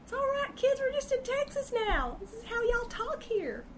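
high, laughing mock Texas accent; playful Texas accent. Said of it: "the y'all bomb starts coming out again".

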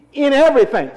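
high, loud call of the verse; emphatic prompt. Shown as a demonstration. Preacher prompting the congregation to say it, emphatic. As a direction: call it out for the crowd to follow. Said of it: "you're not strong to say".

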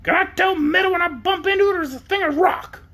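high-pitched hen, gloating; cackly high talking hen; triumphant hen. A narrator's reading. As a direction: crow over being right. Storyteller voicing the gloating hen.

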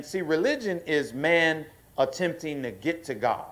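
preaching at full force to the church; rising preaching. Acted. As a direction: drive it home to the congregation.

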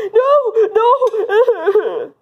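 high-pitched mock wail; pretend crying. Bully asked about their father, mock wailing. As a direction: play a kid bursting into tears. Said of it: "how to make you cry".

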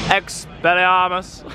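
slow, drawn-out mock incantation; playful wizard incantation; spell casting.

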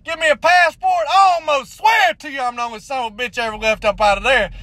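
normal talking voice

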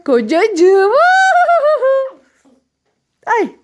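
high, loud delighted echo; delighted squeal. Real, not acted.

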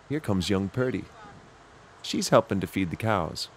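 plain reading voice

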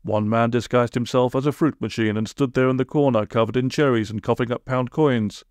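ordinary delivery, nothing marked

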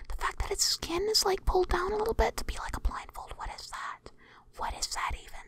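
breathless, voiceless awe; hushed whisper. Real, not acted. Player marveling at the creepy monster design, whispering. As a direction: whisper as if it might hear you.

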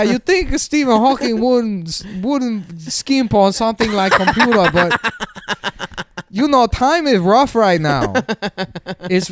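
high put-on African accent voicing Stephen Hawking; hammed-up African accent, laughing between lines; mock African-accented Stephen Hawking. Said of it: "an African voice".

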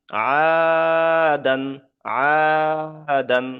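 first vowel of "আদান" stretched long; slow, chanted "আদান" with a drawn-out first syllable; madd elongation. Shown as a demonstration. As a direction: hold the opening "আ" of "আদান" on a steady note, then close on "দান". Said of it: "এক আলিফ পরিমাণ টান দিয়ে পড়তে হবে".

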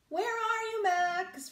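high sing-song call. A narrator's reading. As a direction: call across the garden. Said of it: "Ruby called".

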